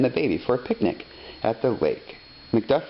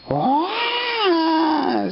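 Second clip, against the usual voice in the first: put-on baby wail. A narrator's reading. Narrator voicing the baby's scream at the music, high wail. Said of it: "screamed the baby".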